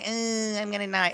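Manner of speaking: high whimpering imitation; mock a weak, whiny crybaby; mock crying victim. Mimics the crying crew member.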